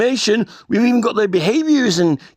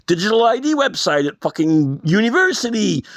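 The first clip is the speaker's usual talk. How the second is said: ordinary talk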